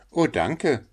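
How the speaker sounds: lightly voiced humble Hans; grateful little Hans. Hans thanks the miller.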